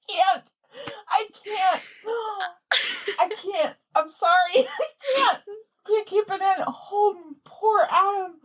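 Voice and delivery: high, tearful laughing fit; can't keep it in, voice squeaking high with real laughter; helpless laughter. Real, not acted. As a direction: squeeze the words out between laughs. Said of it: "can't stop laughing".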